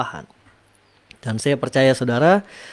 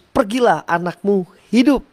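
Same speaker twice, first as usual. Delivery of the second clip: high, ringing command; word of power; 6 semitones higher than usual. Retold or imitated. Jesus's powerful word to the official, high and ringing. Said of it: "perkataan kuasa yang keluar dari mulut".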